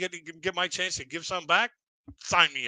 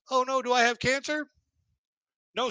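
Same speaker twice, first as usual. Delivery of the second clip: much higher, hammed-up oh no; mock panic; 10 semitones higher than usual. Patient hearing about test results, much higher mock panic. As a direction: squeal the worry, half joking.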